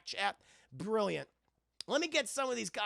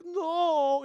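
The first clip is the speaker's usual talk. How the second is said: high, loud wailed cry; mock lament; 9 semitones higher, 9 dB louder than usual. Scotsman mourning his bagpipes, mock anguish. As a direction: melodramatic wail of loss.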